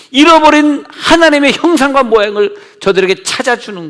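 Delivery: high, loud climax of the sermon; preaching at full force. Acted. Pastor to congregation, preaching high and loud. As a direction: drive it home to the congregation.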